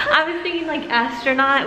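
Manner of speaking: giggling through the words; laughing. Real, not acted. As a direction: laugh while you speak. Laughing about the mask fitting.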